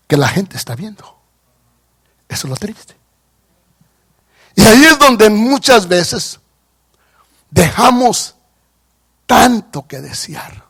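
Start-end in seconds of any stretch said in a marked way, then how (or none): none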